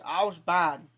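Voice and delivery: usual speaking voice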